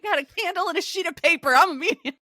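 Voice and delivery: high, fast, loud hammed-up excitement; mock manic announcement. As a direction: rattle it off like an overexcited amateur medium. Co-host mimics chaotic mother announcing seance.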